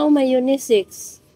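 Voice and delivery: ordinary talk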